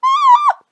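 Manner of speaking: piercing high squeal of fright; frightened scream. A narrator's reading. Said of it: "a scream of fright".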